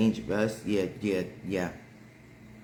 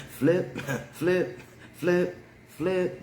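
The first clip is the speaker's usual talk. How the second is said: flip flop sound; 8 semitones higher, much slower than usual. Real, not acted. Imitating flip flop noise.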